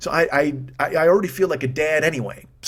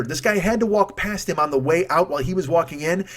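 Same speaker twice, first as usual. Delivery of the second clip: ordinary talk, nothing marked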